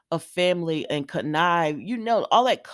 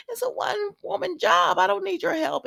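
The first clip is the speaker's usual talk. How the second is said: much higher, mock-airy brush-off; high-pitched retold refusal; 13 semitones higher, 3 dB louder than usual. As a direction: put her refusal up high. Retelling Diane refusing company.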